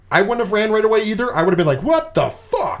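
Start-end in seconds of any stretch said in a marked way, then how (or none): 1.8-2.8 pitched way up in outraged disbelief; mock blow-up, voice jumping high; angry disbelief. Betrayed friend blowing up at the liar, high outrage. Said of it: "as a person who gets angry".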